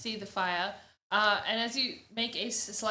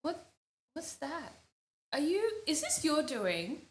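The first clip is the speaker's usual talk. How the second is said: startled suspicion; 5 semitones higher than usual. Acted. Woman spotting the shattered potion, startled and suspicious. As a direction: question it in dawning suspicion.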